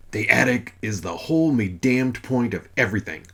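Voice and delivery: loud gruff holler in a put-on voice. God throwing up his hands, hollering at Mother. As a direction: fling it out, arms up. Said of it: "Father hollered".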